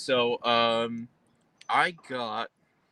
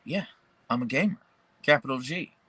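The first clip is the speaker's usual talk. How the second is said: ordinary delivery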